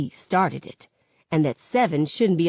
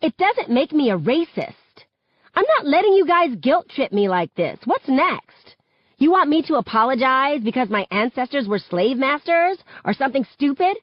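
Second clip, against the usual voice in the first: high-pitched, raised-voice protest; indignant shouting; 8 semitones higher than usual. A narrator's reading. Schoolgirl rejecting a guilt trip over her joke, indignant shouting. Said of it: "she shouts".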